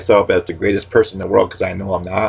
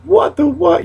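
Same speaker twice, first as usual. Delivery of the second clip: sudden very high squeal; high-pitched mock outrage; squeaky catchphrase; 3 dB louder, slower than usual. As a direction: shoot the voice way up into a squeak. Catchphrase shrieked as a comeback.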